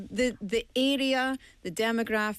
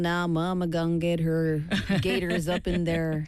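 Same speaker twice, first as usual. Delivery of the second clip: put-on bayou Southern drawl; southern US accent; 3 semitones lower than usual. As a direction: play a mama from the American South. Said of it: "I love the southern accent".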